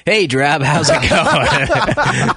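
high, drawn-out friendly greeting; cheery guy voice. Retold or imitated. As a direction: greet her slowly and brightly. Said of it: "this guy comes up to me".

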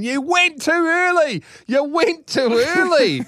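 high-pitched mock female scream; drawn-out high wailing accusation; screaming woman. A narrator's reading. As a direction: shriek it like an outraged mother. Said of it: "her screaming".

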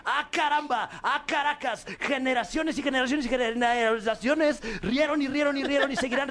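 high, hammed-up countdown announcer; podcast host parodying a grand countdown reveal; mock announcer. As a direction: hype the number one like a show host. Mock announcer hyping top joke.

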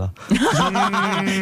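short high giggle; bright burst of laughter; amused laugh. Real, not acted. Laughs at own joke.

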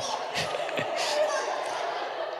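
squeaky breathy giggle; high giggle. Real, not acted. Laughs at the line.

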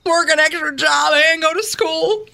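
pleading Southern mama, acted; tearful-edged Southern melodrama; Southern accent. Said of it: "do this in a Southern accent".

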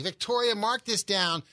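ordinary talk, nothing marked

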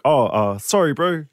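playing up a New Zealand accent to get out of trouble. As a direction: lean hard into a Kiwi "sorry, bro". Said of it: "I really leaned hard into a New Zealand accent".

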